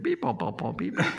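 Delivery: high robotic beep boop. Pretend. Pretending to be an AI.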